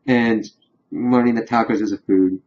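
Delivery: usual speaking voice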